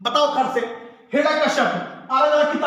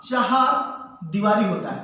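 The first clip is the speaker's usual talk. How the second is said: slow careful demo; 4 semitones lower, slower than usual. Shown as a demonstration. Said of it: "ध्यान सुनना".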